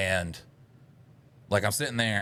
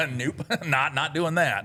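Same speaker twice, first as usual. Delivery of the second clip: laughing, high refusal; 6 semitones higher, slower than usual. Real, not acted.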